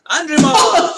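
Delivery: grand announcement. Acted. Host grandly introducing his co-host at sign-off. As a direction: announce the name like a game show host.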